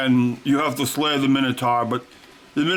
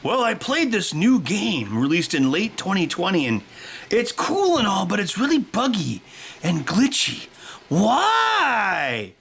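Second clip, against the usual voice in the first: mock reviewer; 6 semitones higher than usual. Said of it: "YouTube game reviewers being like".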